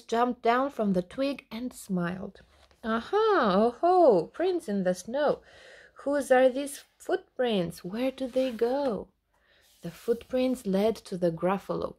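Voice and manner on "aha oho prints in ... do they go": playful discovery. Acted. Mouse echoing the child's rhyme in triumph. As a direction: crow it out triumphantly.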